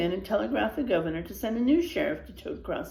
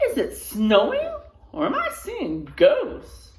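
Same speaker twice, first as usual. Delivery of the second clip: widow's surprised laugh; 8 semitones higher, 5 dB louder than usual. Acted. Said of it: "Widow Jones asked".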